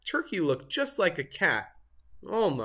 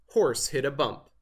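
usual speaking voice

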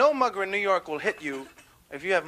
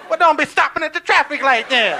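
high, loud scolding; hammed-up irritated complaint, pitched up; indignant passenger; 8 semitones higher, 9 dB louder than usual. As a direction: tell the driver off loudly. Passenger scolding mistaken driver.

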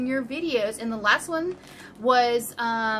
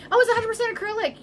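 high, fast, louder; excited; 11 semitones higher, 4 dB louder, faster than usual. Real, not acted. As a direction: rush it out thrilled. Reading the label excitedly.

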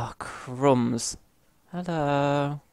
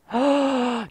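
a sudden high gasp of shock; sharp shocked gasp; 11 semitones higher, 4 dB louder than usual. Real, not acted. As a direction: gasp as the hit lands. Shocked on dying in game.

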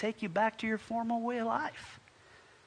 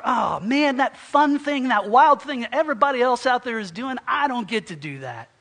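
loud, exaggerated lament at missing out; mock complaint; 3 semitones higher, 8 dB louder than usual. Preacher mimics someone envying everybody else's fun, loud mock complaint. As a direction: whine loudly about being left out of the fun.

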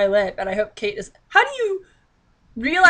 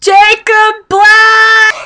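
much higher, much louder, very slow yelled name; shouted realization; 11 semitones higher, 13 dB louder, much slower than usual. Real, not acted. Reader to viewers, yelling at spotting the character. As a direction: bellow the name in triumph.

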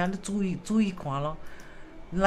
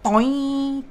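bounce noise; 6 semitones higher, 4 dB louder than usual. Retold or imitated. Sound effect for energy bounce.

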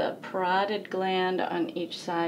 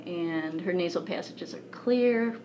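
ordinary talk, nothing marked

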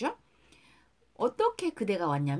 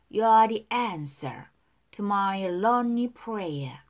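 slow, even teacher's model line; slow model reading; 4 dB louder, much slower than usual. Shown as a demonstration. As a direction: spell each word out slowly. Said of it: "두 번씩 따라 할게요". (천천히 또박또박 시범 발음)

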